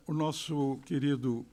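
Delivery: ordinary talk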